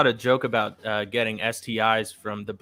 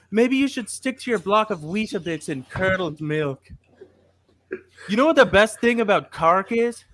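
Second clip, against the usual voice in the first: put-on Irish accent; 9 semitones higher than usual. Podcaster roasting an Irish listener in a put-on Irish accent. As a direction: ham up a County Cork Irish accent with joke punchlines. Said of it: "I wanna work on my Irish accent".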